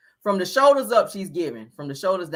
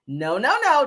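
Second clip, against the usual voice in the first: higher, louder run of no's; Kimmy; 5 semitones higher, 4 dB louder than usual. Retold or imitated. Vlogger refusing a look as Kimmy, imitated voice. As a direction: put on a high, punchy impersonation. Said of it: "This is my Kimmy voice".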